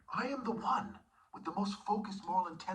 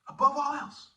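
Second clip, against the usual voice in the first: higher and much louder, punched out; loud proclamation; 9 semitones higher, 9 dB louder than usual. Shown as a demonstration. Preacher shouting the verse at the congregation. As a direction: proclaim it like a command.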